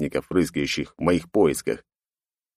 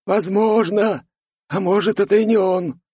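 put-on old man voice; 13 semitones higher, 3 dB louder than usual. A narrator's reading. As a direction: wheeze it like a giddy old man. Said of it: "ответил старик".

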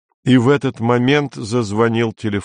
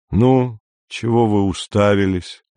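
usual speaking voice

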